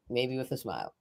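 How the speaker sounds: soft aside. Real, not acted. Poet echoing her own afterthought, soft aside. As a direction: drop it to a quiet echo.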